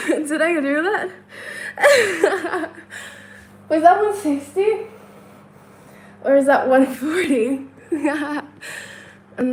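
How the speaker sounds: giggling through the question; laughing. Real, not acted. Counting for stream viewers, laughing through it.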